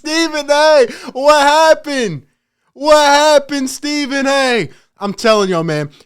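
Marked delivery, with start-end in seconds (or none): 0.0-4.7 high-pitched, drawn-out taunting cry; mock dismay. Host taunting a pundit after his climbdown, mock dismay. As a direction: tease him with stretched, raised questions.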